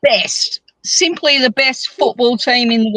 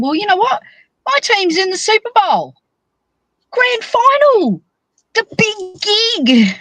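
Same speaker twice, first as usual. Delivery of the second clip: excited boast; 8 semitones higher than usual. Real, not acted. Football fan bragging that her team's in the Super Bowl, excited. Boasting about team reaching final.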